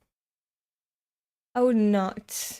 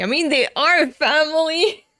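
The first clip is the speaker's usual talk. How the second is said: excited; 6 semitones higher, 7 dB louder than usual. Acted. Character's cheerful exclamation.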